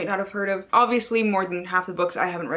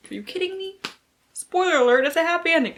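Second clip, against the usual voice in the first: mock exasperation; 9 semitones higher than usual. Real, not acted. Booktuber teasing viewers about happy endings, exasperated. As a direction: throw your hands up at the obvious.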